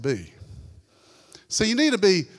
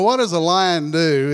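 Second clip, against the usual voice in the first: normal talking voice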